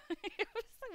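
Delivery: breaking into high laughter; cry laughing. Real, not acted. Streamer recalling the night she cry-laughed, real laughter. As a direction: laugh through the tears. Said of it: "I started cry laughing".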